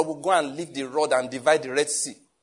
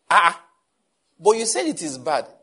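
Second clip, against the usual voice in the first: louder indignant challenge to the secretary; 4 semitones higher, 7 dB louder than usual. Retold or imitated. Pastor loudly challenging his secretary. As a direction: push back hard, louder. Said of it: "I'm just feeling sorry".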